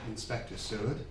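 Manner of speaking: a man at the end of his rope; low, hushed confession. Acted. Minister confessing to the inspector, hushed and low.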